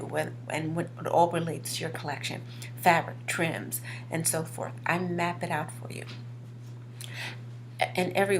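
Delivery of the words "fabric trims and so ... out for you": normal talking voice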